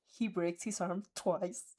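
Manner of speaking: ordinary delivery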